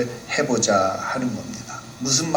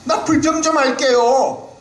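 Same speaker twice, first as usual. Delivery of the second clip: put-on much higher, childlike pleading voice; cute complaining Job; 13 semitones higher than usual. Job playfully announcing to God that he will complain. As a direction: whine to God like a spoiled child. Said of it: "지금 뭐라고 말하는 겁니까".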